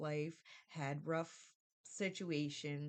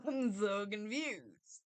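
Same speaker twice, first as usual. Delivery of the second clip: slow, louder laughing words; laughing confusion; 4 semitones higher, 5 dB louder, much slower than usual. Real, not acted. Telling a friend about her sister, laughing in confusion. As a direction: laugh it out slowly in disbelief. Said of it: "I'm confused".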